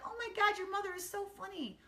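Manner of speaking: high-pitched mock gushing; retold delighted exclamation, high voice; imitated girls exclaiming. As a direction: imitate excited schoolgirls, pitched well up. Retells daughter's friends exclaiming.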